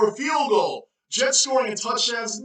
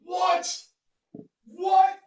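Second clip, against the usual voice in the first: high, stunned what; surprised; 9 semitones higher than usual. Real, not acted. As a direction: react in disbelief. Disbelief at big play.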